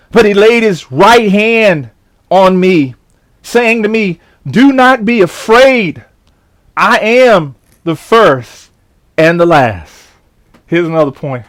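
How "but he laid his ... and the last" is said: slow scripture reading. Acted. Reads scripture slowly.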